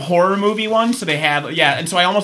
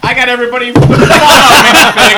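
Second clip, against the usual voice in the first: loud, high, laughing reveal; excited laughing; 6 semitones higher, 9 dB louder than usual. Real, not acted. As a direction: announce the gift with glee. Revealing Christmas gift surprise.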